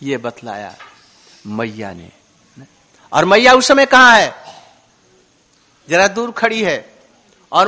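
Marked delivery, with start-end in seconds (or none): 0.0-2.1 told in the ear. A narrator's reading. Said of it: "अंत में उनके कान में".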